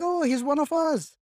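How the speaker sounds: a local beaming at a newcomer; delighted welcome. Retold or imitated. Locals greeting a newcomer who speaks their dialect, high delighted welcome. Locals accepting a fluent outsider.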